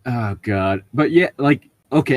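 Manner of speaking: normal talking voice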